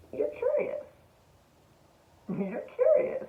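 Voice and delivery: very high, playful "you're curious"; teasing squeak. Real, not acted. Telling the viewers why they came here, in a high playful squeak. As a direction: squeak it like a secret.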